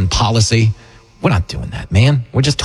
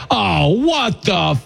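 shocked audience; 6 semitones higher, much slower than usual. Retold or imitated. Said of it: "react to it like".

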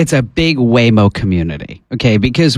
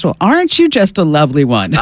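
ordinary delivery

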